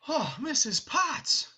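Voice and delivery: high, slow, overjoyed cry; Beast's joyful cry. Shown as a demonstration. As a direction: cry out her name with delight. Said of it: "he will end up saying".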